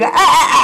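sudden high mock scream of a mother in labour; retold scream. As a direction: yelp out a short imitated birth scream, high and sharp. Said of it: "the mother is screaming".

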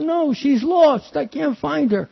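put-on high, childlike wail; high pleading child voice; little lost boy. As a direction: voice yourself as a crying four-year-old. Lost child answers officer.

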